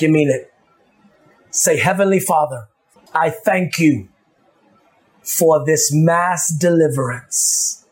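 a pastor praying low and hushed over his people; fervent prayer. Acted. Leads the congregation in prayer.

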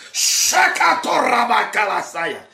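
shouted burst of tongues, unlike his own voice; speaking in tongues. Real, not acted. Preacher bursting out in shouted tongues, then apologizing. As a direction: release a sudden cry of tongues. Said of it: "I had to release that".

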